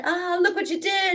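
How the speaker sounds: high-pitched mock alarm; imitated janitor. Janitor to a child who moved a desk, high mock alarm. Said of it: "And he said".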